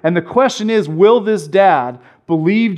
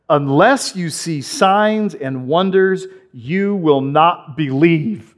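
solemn Jesus quote; slower than usual. Acted. Preacher reads Jesus' words slowly.

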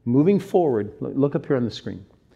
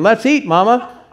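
"Let's eat, mama." with a break before "mama"; pause before mama; 7 semitones higher, 6 dB louder than usual. Shown as a demonstration. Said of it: "is one way of saying".

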